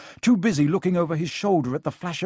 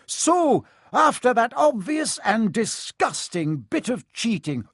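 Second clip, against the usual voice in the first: biased commentator; 8 semitones higher, slower than usual. A narrator's reading. Commentator unable to stay neutral about cheating, outraged. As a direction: announce it with open disgust, pitched up. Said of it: "finding it difficult not to take sides".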